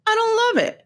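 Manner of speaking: high, pouting I don't love it; mock dismay. Real, not acted. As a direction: whine it out in comic disapproval.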